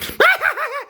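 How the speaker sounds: piercingly high, loud cackle; nervous laughing fit. Acted. Scared man on the subway, loud nervous cackle. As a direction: cackle helplessly, not amused. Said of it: "he laughs when he's nervous".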